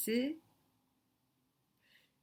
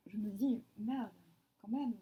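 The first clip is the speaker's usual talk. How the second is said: whispering. Real, not acted. Musing to herself aloud.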